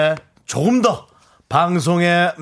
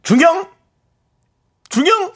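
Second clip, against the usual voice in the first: mock puzzled; 12 semitones higher, 4 dB louder than usual. Teasing mock question to listeners.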